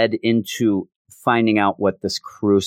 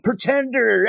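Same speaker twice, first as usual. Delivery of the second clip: high, hammed-up accusing yell; mock crowd yell; 12 semitones higher than usual. Cult kids jeering the Scarlet Witch as a pretender, high mock yell. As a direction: shout it like a fanatic follower, but playfully. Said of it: "They're all yelling".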